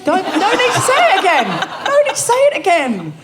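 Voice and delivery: high, loud, panicked objection; flustered protest. Real, not acted. Stops co-host repeating rude line.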